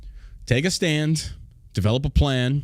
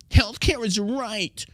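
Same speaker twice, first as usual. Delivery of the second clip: mocking whiny voice; 7 semitones higher than usual. Host parodying hypocritical opponents in a higher sneering voice.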